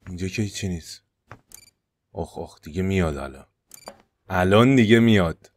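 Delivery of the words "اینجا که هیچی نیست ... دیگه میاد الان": much softer, lower worried murmur; whisper-close fear as he nears; hushed dread. Real, not acted. Player cornered as the neighbour comes, hushed.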